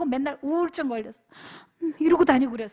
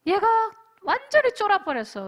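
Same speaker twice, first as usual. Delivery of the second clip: normal talking voice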